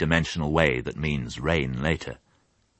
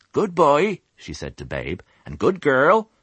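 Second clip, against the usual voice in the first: high, louder fond farm wife; farmer's wife; 10 semitones higher, 3 dB louder than usual. Acted. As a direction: pat them and praise. Mrs Hoggett pats animals.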